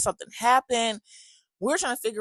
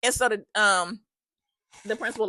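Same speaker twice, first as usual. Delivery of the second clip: ordinary talk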